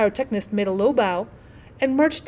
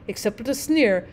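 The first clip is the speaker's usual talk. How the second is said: ordinary delivery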